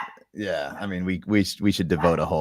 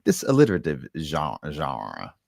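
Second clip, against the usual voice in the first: Trebek-style genre. Retold or imitated. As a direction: roll out genre French-style, falling. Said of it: "does the Alex Trebek".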